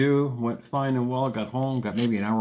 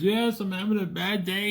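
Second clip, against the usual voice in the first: a higher, teary imitation of her; tearful; 10 semitones higher than usual. Imitating the tearful lady admitting her bad day, higher and teary. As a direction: mimic a woman close to tears. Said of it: "You can see the tears in her eyes".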